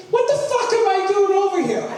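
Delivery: high, laughing exasperated line; laughing retort. Retold or imitated. Uncle baffled at the meter, laughing high retort. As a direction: blurt it out between laughs.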